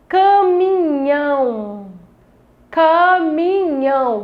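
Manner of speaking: CAMINHÃO stretched very slowly, each syllable held, NHÃO stressed; intense syllable reading. Shown as a demonstration. Teacher shows how to find the tonic syllable, Caminhão drawn out emphatically. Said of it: "ler com maior intensidade a palavra".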